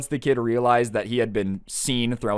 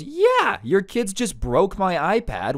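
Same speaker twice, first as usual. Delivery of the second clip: much higher, slowed, exasperated yeah; indignant outburst; 8 semitones higher, slower than usual. Retold or imitated. Cousin telling the kids' mom she owes him an iPad, indignant. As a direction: hit her with the blame, fed up.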